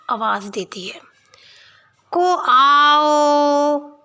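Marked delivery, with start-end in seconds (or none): 2.1-4.0 calling out. Acted. Old woman calling daughter.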